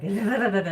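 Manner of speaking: laughing trilled da da da; mock rolled R, loud and laughing; imitated rolled R. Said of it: "And he's like".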